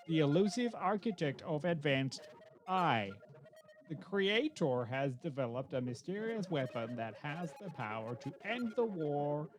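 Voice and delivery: put-on like calming a toddler; slow patient explaining. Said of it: "explaining very slowly to a three-year-old".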